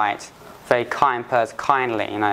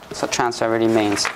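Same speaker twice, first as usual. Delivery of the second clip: ordinary talk, nothing marked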